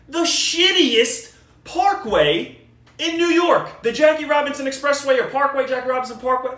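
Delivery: exasperated complaint at full volume; indignant rant. Real, not acted. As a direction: rail against the road, hammering it. Ranting about the parkway's traffic.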